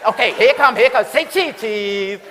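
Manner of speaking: high, loud cheerful camera voice; mock photo cheer. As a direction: coax the family to smile for the picture. Photographer posing family.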